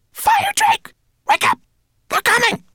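very high, loud alarm cry; urgent shout. A narrator's reading. Rat waking the sleeping dragon, urgent shouting. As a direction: shriek the warning to rouse him. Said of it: "she shouted".

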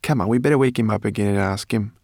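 quick, loud, pressing; urgent urging. A narrator's reading. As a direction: hurry them along impatiently. Sorrel urges waking the dragon.

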